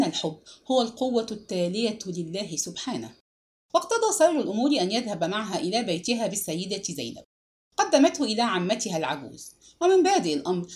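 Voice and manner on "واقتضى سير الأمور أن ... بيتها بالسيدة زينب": usual speaking voice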